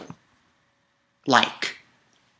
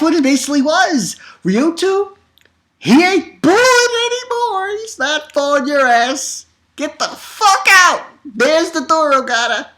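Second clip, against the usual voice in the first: high, loud, excited ranting; fired-up fan cheering the hero; hyped up; 12 semitones higher, 3 dB louder than usual. Real, not acted. Hyped retelling.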